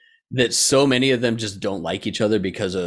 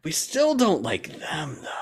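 mock grudging aside; 4 semitones higher, 4 dB quieter than usual. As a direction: draw it out as a grudging, higher-pitched aside. Repeats the mimicked dislike, softer.

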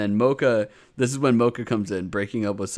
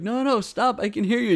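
put-on much higher frantic kid voice; mock kid panic, high up; high panicked kid; 12 semitones higher than usual. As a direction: squeal it like a kid caught out.

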